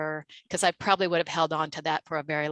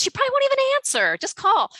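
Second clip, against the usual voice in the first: high, fast, loud urging from the boss; insistent manager; 5 dB louder, faster than usual. Retold or imitated. Manager pressing a nervous employee, insistent fast shout. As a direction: push her hard to just make the call. Manager pushing her to call.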